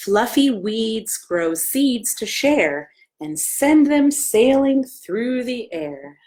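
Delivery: slow, gentle rhyme reading; reading to children. Acted. Librarian reading a picture book rhyme to children. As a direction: read the verse lovingly to little ones.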